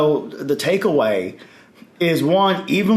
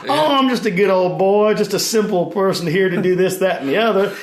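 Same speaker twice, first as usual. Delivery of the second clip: mock Southern accent; 3 semitones higher than usual. Gaming fellow playing the simple Southern good old boy, mock Southern accent. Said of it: "he has a very strong Southern accent".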